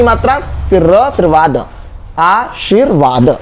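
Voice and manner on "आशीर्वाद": slow "आशीर्वाद", aa-sheer-vaad, r clearly after shee; slow correct demo. Shown as a demonstration. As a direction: model "आशीर्वाद" slowly for copying. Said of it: "ये सही है".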